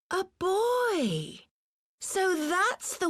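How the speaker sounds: sudden high exclamation of surprise; surprised. Acted. Assassin startled to find a boy, surprised high exclamation. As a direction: caught off guard, voice jumping up. Assassin discovers an unexpected foe.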